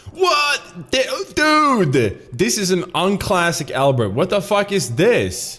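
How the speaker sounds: high-pitched, incredulous outburst; real shocked outburst. Real, not acted. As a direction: burst out in high-pitched disbelief. Startled outburst at the game.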